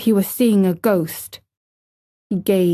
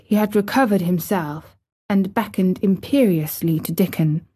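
ordinary delivery, nothing marked

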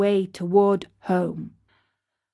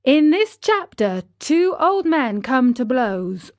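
ordinary delivery, nothing marked

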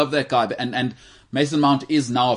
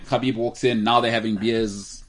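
ordinary delivery

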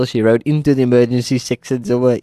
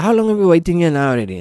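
impatient question; 6 semitones higher, faster than usual. Retold or imitated. Impatient in waiting room.

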